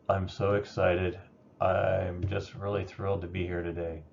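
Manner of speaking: flat, unenthused. Shown as a demonstration. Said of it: "Same words, but the tone and inflection completely changed it".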